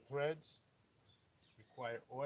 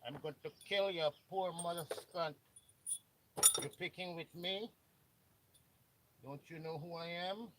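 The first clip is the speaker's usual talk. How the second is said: loud, higher-pitched threatening yell in a mock Mexican accent; ranting mock bully, much louder, in a Mexican accent; mock angry American; 4 semitones higher, 7 dB louder than usual. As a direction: shout threats as the rude American, hammed up. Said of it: "He say".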